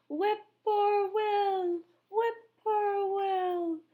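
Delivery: high, very slow bird call; whippoorwill call. A narrator's reading. Whippoorwill singing over the dark field, high and slow. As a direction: sing it like a lonely night bird. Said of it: "A whippoorwill sang out".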